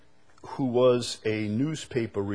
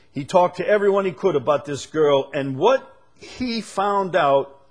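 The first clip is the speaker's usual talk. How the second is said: emphatic; 8 semitones higher, 7 dB louder than usual. Real, not acted. Preacher building to emphasis.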